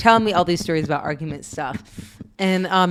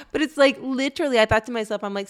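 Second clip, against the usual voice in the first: ordinary talk, nothing marked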